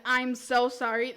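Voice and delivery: normal talking voice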